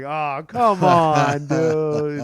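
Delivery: slow, drawn-out come on; mock protest. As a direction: stretch it out, brushing it off. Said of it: "I was like".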